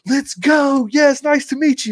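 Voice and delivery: bursting out of the cab with excitement; pumped arrival. Retold or imitated. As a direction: greet him like you can't contain it. Retelling his excited arrival.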